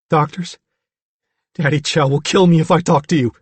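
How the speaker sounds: high, loud, fearful character voice; frightened Billy. A narrator's reading. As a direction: confess it like a scared boy. Billy fears his father.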